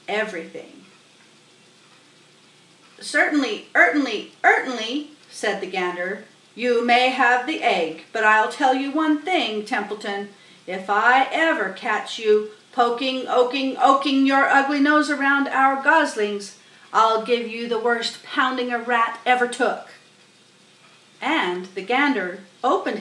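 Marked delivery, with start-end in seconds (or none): 3.0-5.1 higher, louder repeated certainly; gander's voice. Acted. Gander agreeing with the goose, repeating himself. 6.6-19.9 loud menacing gander; gander's threat. Acted. Gander threatening Templeton, loud and menacing.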